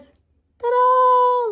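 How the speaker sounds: high, loud ta-da flourish; ta-da reveal. Real, not acted. Showing viewers the little crystal, a high singing ta-da.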